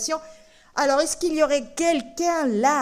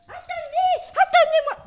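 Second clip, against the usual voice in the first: very high, loud little puppet calling out; high puppet shout; 7 dB louder than usual. Acted. Puppet Tolo calls from the bag, loud high shout. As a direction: shout from inside the bag as a tiny creature. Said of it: "la voix vient de mon sac".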